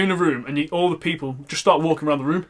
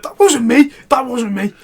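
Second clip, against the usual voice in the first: fuming denial; 7 semitones higher, 6 dB louder than usual. Retold or imitated. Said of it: "He was like".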